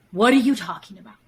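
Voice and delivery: gaslighting partner dismissing the complaint quickly. Acted. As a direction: brush it off fast as if baffled. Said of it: "I literally look at you and I go".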